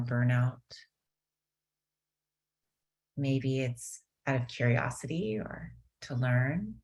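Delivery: unhurried calming guidance; guided meditation. Acted. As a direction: ease them gently into stillness.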